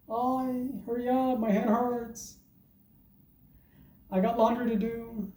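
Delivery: mock impatient partner, pitched high and quiet; bored impatient woman. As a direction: whine it like she wants it over. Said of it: "They just wanna get it over with".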